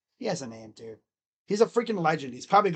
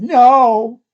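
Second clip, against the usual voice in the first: higher, louder mock cat reply; cat saying no; 5 semitones higher, 14 dB louder than usual. Streamer voicing his pessimistic cat's answer, higher and louder. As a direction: voice the cat's blunt answer, loud. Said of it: "Literally said".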